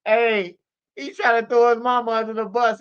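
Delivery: normal talking voice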